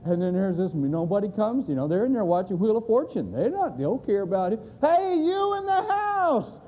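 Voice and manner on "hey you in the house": long, high holler, every word stretched; yelling. Retold or imitated. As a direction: shout to people who aren't listening. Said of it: "he's yelling down at 'em".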